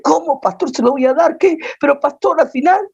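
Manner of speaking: high, startled protest; disbelieving wife protesting to the pastor; shocked disbelief. Retold or imitated.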